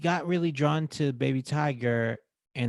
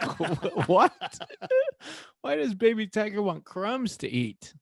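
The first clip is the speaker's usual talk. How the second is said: higher-pitched, breaking into laughs; laughing disbelief; 9 semitones higher than usual. Retold or imitated. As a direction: ask incredulously through laughter. Laughing disbelief at the child's request.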